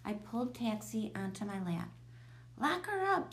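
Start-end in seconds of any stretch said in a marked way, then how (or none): 2.6-3.3 high, loud urgent scream. A narrator's reading. Brian screams at his neighbor to shut the cat away. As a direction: shout the order, pitched right up. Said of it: "screamed Brian".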